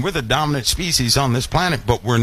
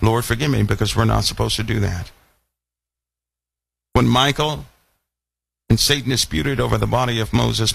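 normal talking voice

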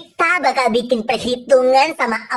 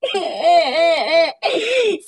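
sobbing wail; 3 semitones higher than usual. Acted. Framed schoolgirl wailing to her friends. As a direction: wail like a wronged child.

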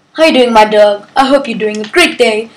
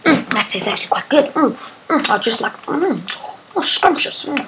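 1 savoring food; 3 semitones higher, 7 dB quieter than usual. Real, not acted.

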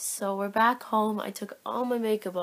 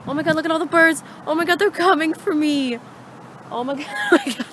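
excited; 8 semitones higher, 9 dB louder, faster than usual. Real, not acted. Birds chasing her.

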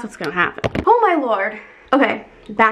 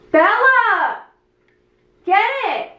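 calling the dog; 10 semitones higher, much slower than usual. Real, not acted. Calling dog to catch cricket.

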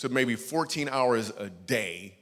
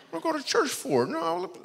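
incredulous. Retold or imitated. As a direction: pitch jumps high in disbelief. Incredulous refusal of church invite.

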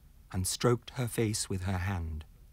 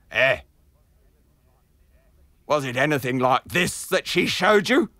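loud, higher sinister taunt; eerie cry; 5 semitones higher, 10 dB louder than usual. Acted. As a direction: reveal the faceless horror with a cry. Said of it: "cried the noodle seller".

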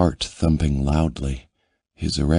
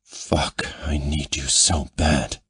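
grate it out roughly; grated. A narrator's reading. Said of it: "he grated".

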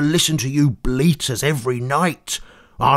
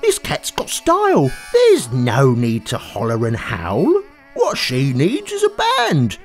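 high, bouncy, delighted; lively frog; 6 semitones higher, 3 dB louder than usual. Acted. George hears the harmonica, high and excited. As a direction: hear the rhythm and light up.

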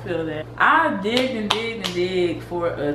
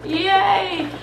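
cheering; 9 semitones higher than usual. Real, not acted. Cheering the challenge.

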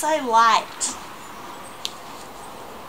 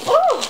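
long breathy gasp then a high loud ooh; gasp, then squeal ooh; delighted gasp and ooh; 6 dB louder than usual. Real, not acted. Vlogger unboxing dance tops, delighted gasp and ooh. Box inside the bag.

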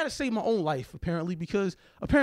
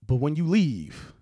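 normal talking voice

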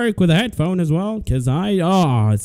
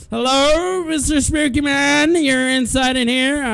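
high calling voice; 5 semitones higher than usual. Real, not acted. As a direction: call out high, stretching the hello. Nervously calling out to monster.

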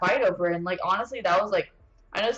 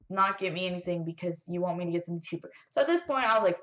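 usual speaking voice

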